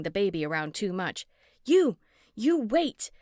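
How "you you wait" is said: loud, high furious shout; screaming. A narrator's reading. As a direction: scream after the fleeing man. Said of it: "I screamed".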